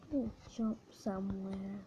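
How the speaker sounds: whispered to himself. Real, not acted. Player whispering to himself while sneaking. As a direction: breathe it out, sneaking.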